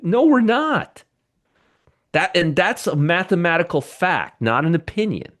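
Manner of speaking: high-pitched, worked-up insistence; too excited. Real, not acted. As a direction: get carried away, pitch shooting up. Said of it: "I'm getting too excited".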